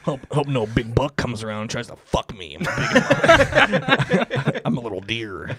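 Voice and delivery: silly singsong animal character; little deer voice. Pretend. Little deer prancing around in the forest, silly character voice. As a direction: play an innocent little deer, dopey and carefree.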